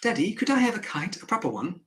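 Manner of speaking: lightly voiced hopeful child; child's request. Acting child asking father.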